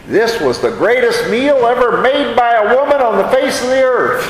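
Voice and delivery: lightly mocking grand praise; mock hyperbole. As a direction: oversell it like the best ever. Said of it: "we love to say".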